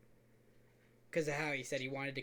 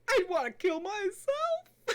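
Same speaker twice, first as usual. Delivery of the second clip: slow, high, whiny fake cry; high wailing mock voice; 6 dB louder, slower than usual. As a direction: wail it like a hammy sob. Retelling predator's reply.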